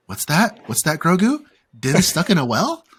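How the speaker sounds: playful dog-owner parody; Lassie-style questioning. Acted. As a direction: ask it like talking to a clever dog. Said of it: "and then he was like".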